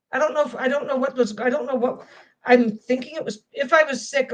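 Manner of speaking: ordinary delivery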